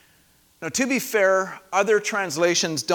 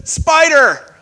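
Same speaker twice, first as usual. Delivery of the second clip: high, loud cry of "Spider"; a startled warning cry about a spider; alarmed warning; 9 semitones higher, 12 dB louder than usual. Retold or imitated. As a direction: yelp it like you just saw one. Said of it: "someone says".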